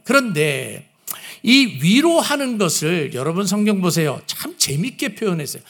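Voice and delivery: usual speaking voice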